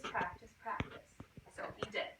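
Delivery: soft unvoiced whisper. A narrator's reading. Whispering the cousins' advice to the children. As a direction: breathe it out like a secret.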